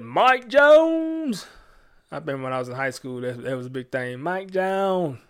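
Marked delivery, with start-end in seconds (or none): 0.0-1.5 name drawn out slow and high in a Houston accent. Shown as a demonstration. Radio host announcing the rapper in a hyped Houston drawl. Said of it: "I have to work on my Houston accent". 4.2-5.2 Houston accent attempt. Shown as a demonstration. Host retrying the rapper's name in a Houston accent. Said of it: "I can't even say it right".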